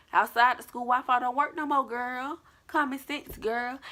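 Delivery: high-pitched sassy self-reproach; mock scolding. Chiding self for running out of Wi-Fi range, mock scolding. Scolding own dumb mistake.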